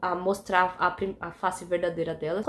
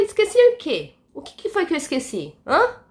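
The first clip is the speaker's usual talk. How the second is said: high, loud demand; shrill-peaked, loud confused challenge; indignant disbelief; 8 semitones higher, 7 dB louder than usual. Retold or imitated. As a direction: throw the question back at her, outraged. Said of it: "Aí eu falei".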